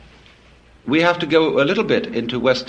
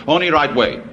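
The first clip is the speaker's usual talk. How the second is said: "Only right way" clipped, with "Only" pressed hard; lightly voiced monk, firm and clipped on "Only right way"; monk's broken English. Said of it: "a skinny Buddhist monk".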